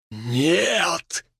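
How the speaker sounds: distorted voice shouting no; otherworldly distorted cry; eldritch horror. A narrator's reading. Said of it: "Искажённый голос Дмитрия".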